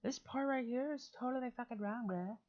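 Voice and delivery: high, bro-speak callout; mock bro voice; imitating bro. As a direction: act the bro telling him off. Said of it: "You should have been like".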